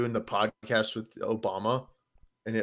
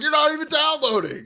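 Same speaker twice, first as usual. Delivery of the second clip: complaining to wife; 14 semitones higher, 3 dB louder than usual. Retold or imitated. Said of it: "I was just like".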